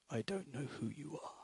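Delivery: low, soft whispered calm; Liam Neeson. Retold or imitated. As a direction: hush it like a cool-headed ex-agent dad. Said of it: "This is dramatic".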